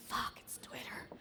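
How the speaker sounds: slow, hushed muttered curse; whispered curse. Retold or imitated. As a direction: breathe the curse out under your breath. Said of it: "you see the brand manager go".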